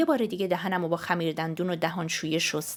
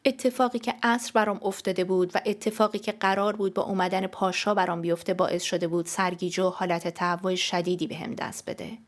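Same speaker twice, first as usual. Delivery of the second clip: ordinary delivery, nothing marked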